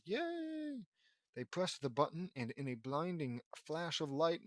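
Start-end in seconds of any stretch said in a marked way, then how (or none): 0.1-0.8 high, drawn-out little cheer; mock cheer. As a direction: squeal it in playful celebration. Cheering an expected happy ending.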